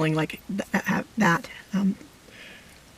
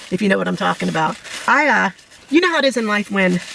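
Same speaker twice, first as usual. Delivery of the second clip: normal talking voice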